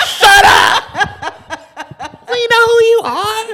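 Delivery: very high, loud shriek through laughter; drawn-out, high heckling yell; laughing shout. Acted. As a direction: screech it while cracking up. Laughing heckle of suspected informants.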